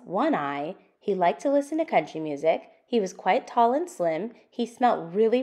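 lively, sing-song picture book reading; reading to children. Acted. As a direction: read it out for little listeners, building the list. Reads the picture book aloud.

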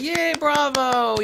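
slow, high mock "bravo" cheer. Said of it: "You go".

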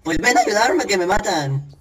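high urgent call for help; panicked plea. Real, not acted. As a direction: beg for rescue in panic. Begging for help while attacked.

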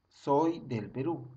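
Soy del Perú said slowly, each word apart; slow, spelled-out phrase. Shown as a demonstration. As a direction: spell out the mnemonic slowly for students. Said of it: "Otra vez". ("Soy del Perú" dicho lento, palabra por palabra)

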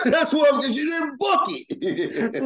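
high-pitched, laughing blurt; real laughter pushing the words high; laughing tease. Real, not acted. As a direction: crack up while teasing, voice shooting way up. Teasing host over short Zoom.

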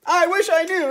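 high, loud exasperated outburst; exploding. Acted. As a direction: burst out in frustration. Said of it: "exploded the conductor".

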